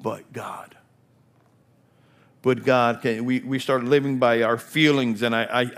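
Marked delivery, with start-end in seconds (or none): 0.0-0.8 hushed, breathy "But God" on a falling tune; a quiet, reverent whisper of "But God". Acted. Dramatic whispered refrain. 2.4-3.0 "But God" called out louder at normal pitch; proclaiming. Acted. Refrain repeated at full voice.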